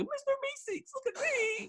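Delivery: very high squeaky cartoon voice; Mister Meeseeks. Drunk friend quoting the show half-asleep, Meeseeks impression. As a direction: squeal it softly like a cartoon creature. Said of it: "Mister Meeseeks came on and I'd just be like".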